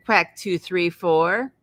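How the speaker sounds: usual speaking voice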